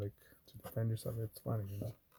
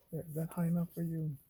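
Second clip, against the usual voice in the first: higher, mock-sweet snide question; sarcastic comeback; 7 semitones higher than usual. Imagined barista answering the customer, snide and mocking. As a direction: needle the customer with it.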